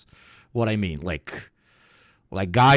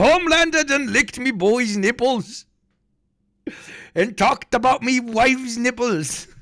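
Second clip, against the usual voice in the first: high, hammed-up Aussie voice; mock Australian accent; 11 semitones higher than usual. Repeating the joke, high mock Australian accent. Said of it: "I can't do the Australian accent".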